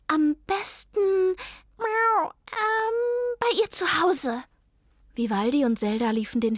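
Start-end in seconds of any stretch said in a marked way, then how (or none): 0.1-4.4 much higher, slow squeaky animal voice; worried high character voice; Zelda's voice. Acted. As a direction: play the little dog, worried.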